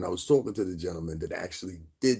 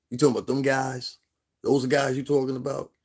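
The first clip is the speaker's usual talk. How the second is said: higher, louder mock-incredulous questioning; incredulous retold challenge; 6 semitones higher, 5 dB louder than usual. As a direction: challenge him in disbelief, re-enacting it. Replays challenging Chris about his guys.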